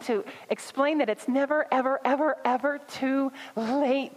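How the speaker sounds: rising, fervent preacher build on never; preaching. Acted. Pastor urging her congregation never too late, fervent preaching.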